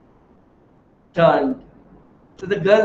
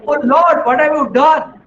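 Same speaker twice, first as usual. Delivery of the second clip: higher, louder anguished plea; anguished cry to the Lord; 7 semitones higher, 5 dB louder than usual. Acted. Man who lost everything cries out to Lord, anguished. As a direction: cry out to God over the lost family. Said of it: "he starts crying".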